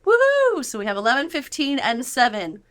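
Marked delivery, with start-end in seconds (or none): 0.0-0.7 high, loud woohoo; excited cheer. Real, not acted. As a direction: cheer them on with a whoop. Host cheers the ages.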